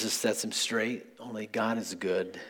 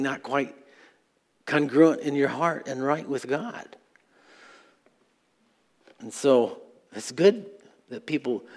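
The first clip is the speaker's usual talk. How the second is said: louder, higher preaching voice pressing the room; preaching at full force; 3 semitones higher, 7 dB louder than usual. Acted. Pastor urging the congregation and internet listeners, building preaching. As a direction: drive the challenge home to the congregation.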